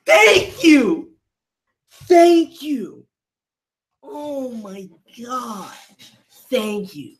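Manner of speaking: high, loud, grateful shout; elated cheer. Real, not acted. Thanking the character for finally acting, an elated cheer. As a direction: cheer like your hero just showed up.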